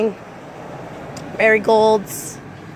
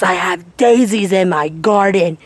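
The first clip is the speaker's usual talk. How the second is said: ordinary talk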